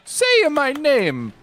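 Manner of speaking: high, slow Mario-style mash-up. Acted. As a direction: tough catchphrase in a high cartoon plumber voice.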